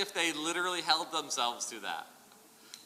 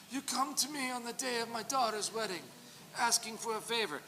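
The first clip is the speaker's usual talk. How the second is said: Godfather quote; 7 semitones higher than usual. Pretend. The Don to someone asking a favor, mock Godfather voice. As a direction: intone it like the Don granting audience. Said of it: "my best Godfather impersonation".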